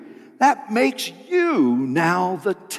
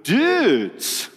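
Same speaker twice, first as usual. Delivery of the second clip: lightly voiced laid-back surfer turtle, higher; Crush surfer dude; 5 semitones higher than usual. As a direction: drawl it like a stoner sea turtle. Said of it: "my full Crush impersonation".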